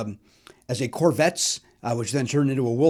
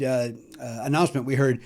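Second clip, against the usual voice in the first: ordinary talk, nothing marked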